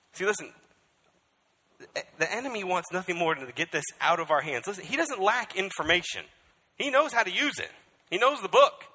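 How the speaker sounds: loud, high sermon voice; full-force preaching. Acted. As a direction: hammer the warning home.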